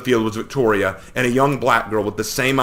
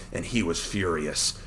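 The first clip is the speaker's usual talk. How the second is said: usual speaking voice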